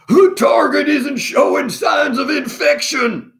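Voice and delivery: very high, slow military bark; strained high-pitched officer's shout; barking commander. A narrator's reading. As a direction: bark the report like an alarmed commander. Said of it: "barked Commander Flem".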